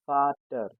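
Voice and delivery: Vater said "FAH-ter"; Vater, f at the start; German pronunciation. Shown as a demonstration. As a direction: teach Vater with f for V. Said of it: "sounds as F".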